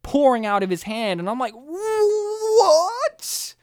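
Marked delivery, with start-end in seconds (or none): 1.5-3.5 long, high drawn-out "what"; shocked what. Retold or imitated. Podcaster reliving a shock moment in the film, stunned. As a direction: stretch it out, climbing high in disbelief.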